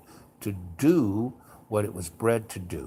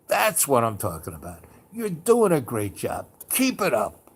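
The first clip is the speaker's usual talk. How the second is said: theatrical outside voice; 4 dB louder than usual. Retold or imitated. Cheering on a screaming two-year-old, big theatrical outside voice. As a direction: egg them on loudly, rising in pitch as it builds. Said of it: "theatrical outside voice".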